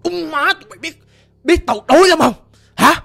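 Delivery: loud, high-pushed angry ghost shouting; acted-out spirit cursing at the people chasing it; possessed man's voice. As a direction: yell it demandingly as the possessing spirit. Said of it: "cái này là cái giọng đàn ông rõ ràng, đặc sệt".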